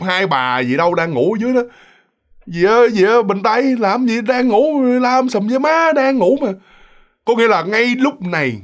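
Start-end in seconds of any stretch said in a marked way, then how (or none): 2.5-6.5 high, drawn-out grumbling at being woken; acted annoyed complaint. Sleeping roommates scold the one who woke them, high annoyed complaint. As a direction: whine in protest, pitch raised throughout.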